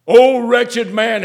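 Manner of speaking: loud, high and slow outcry; anguished cry. Acted. Cry repeated at full force.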